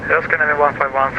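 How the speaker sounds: usual speaking voice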